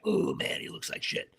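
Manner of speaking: soft unvoiced whisper of disappointment; whispered aside. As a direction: mutter it under your breath. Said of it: "you're like".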